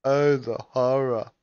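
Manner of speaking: loud, slow mock horror; dramatic horror. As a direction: ham up the horror.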